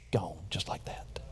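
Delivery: hushed whisper of wonder. Acted. Sermon climax on forgiven sins.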